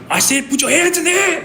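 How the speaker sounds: very high mock scream; strained high yell, not truly loud; imitated police screaming. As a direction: re-enact the officer screaming the order. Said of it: "he was screaming so loud at me".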